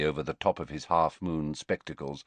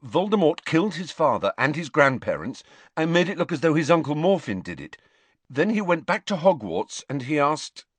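higher, eager, hurried summary; quick recital; 8 semitones higher, 4 dB louder than usual. A narrator's reading. Pupil recaps the story for headmaster, quickly. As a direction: reel off the answer keenly. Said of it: "said Harry quickly".

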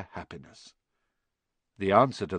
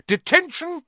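much higher, loud shout; 5 dB louder than usual. Acted. McGonagall catching Malfoy out of bed, shouting. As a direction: bark the punishment at him. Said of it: "she shouted".

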